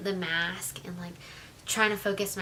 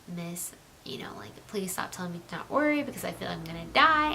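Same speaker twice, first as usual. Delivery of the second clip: whispered, scared pleading; frightened whisper. Retold or imitated. Patient whispering to the nurse that she fears dying, afraid. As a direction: beg her in a hush to stop saying don't worry.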